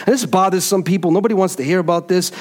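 ordinary talk, nothing marked

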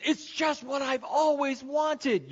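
mock overjoyed kid, high and drawn out; hammed-up, slow, high-pitched thrill; over-exaggerated delight. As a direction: gush like a child unwrapping a present. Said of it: "then the over-exaggeration".

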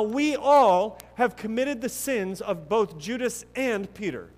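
earnest rising sermon emphasis; preaching at full force. Acted. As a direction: lean into the congregation with conviction. Preacher pressing the theme of failure.

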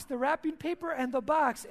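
ordinary talk

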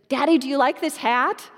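a small daughter begging her dad; eager little girl. Retold or imitated. Little daughter voiced in story.

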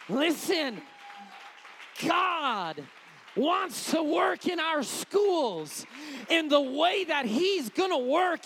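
loud, slowed, pounding pulpit delivery; full-force preaching. Acted. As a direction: lean into every word, rising to a cry. Climax of sermon on schools.

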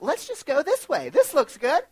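high, breezy carefree friend voice; imitating Shelly. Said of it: "And Shelly's like".

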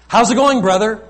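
loud, high cheery hello; hearty greeting. Retold or imitated. Preacher mimics a cheery churchgoer's loud hello. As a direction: greet him with a big hearty call. Greeting the man years later.

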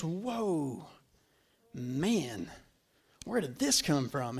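low, hushed, sleepy wonder; groggy murmur. Acted. Just woken on deck, looking at storm, groggy low murmur. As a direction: play someone just awake, dropping very soft and low.

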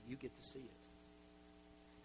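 secret whisper. Acted. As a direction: let him in on a secret in a whisper. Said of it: "I'm gonna let you in on a secret".

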